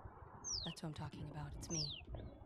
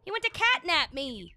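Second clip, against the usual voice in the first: much higher, louder mock outrage; indignant rant; 9 semitones higher, 7 dB louder than usual. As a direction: rant about being catnapped. Indignant rant after attack.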